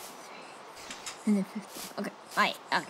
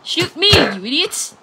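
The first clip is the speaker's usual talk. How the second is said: angry llama voice; 6 semitones higher, 8 dB louder than usual. Retold or imitated. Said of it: "Will they get angry at me and said".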